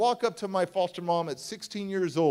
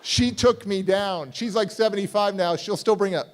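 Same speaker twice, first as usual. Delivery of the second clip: ordinary delivery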